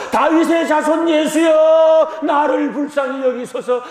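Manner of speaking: full-voiced desperate plea, stretched out. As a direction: shout it out harder, refusing to be silenced. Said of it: "아까보다 더 큰 소리로".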